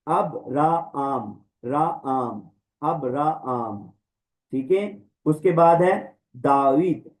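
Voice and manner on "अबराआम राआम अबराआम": very slow अबराआम, syllables apart; अबराआम, long aa held; drilled pronunciation. Shown as a demonstration. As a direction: repeat अबराआम for students. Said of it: "अब्राहम नहीं है ये".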